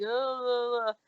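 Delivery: imitated drunken, wordless mumble; slurred garble, as if unable to speak; mumbled slurring. Girl showing how her words came out at the nurse's, mumbled slurring. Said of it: "my words are like".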